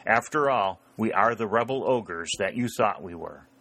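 ordinary delivery, nothing marked

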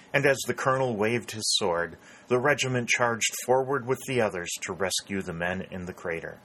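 ordinary delivery, nothing marked

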